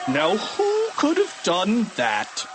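slow, high, exasperated question; principal's drawn-out demand; Mister Krupp. A narrator's reading. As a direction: stretch the question out, swooping high. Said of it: "asked Mister Krupp".

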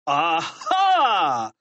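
drawn-out gotcha shout; triumphant yell. A narrator's reading. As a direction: yell it as if catching culprits. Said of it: "yelled Mister Krupp".

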